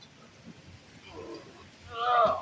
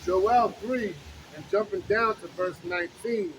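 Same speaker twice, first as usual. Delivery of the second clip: slow, loud preaching read; booming street-preacher reading; scripture reading; 2 semitones higher, 3 dB louder, slower than usual. Acted. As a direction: proclaim the verses to the crowd. Reads scripture aloud on street.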